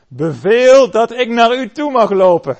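high, loud call across the water; eager call out. Acted. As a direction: shout it to Jesus from the boat, eager. Peter calls out from the boat.

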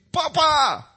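put-on very high, loud held scream of a lost toddler; panicked child's scream. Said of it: "toen riep hij".

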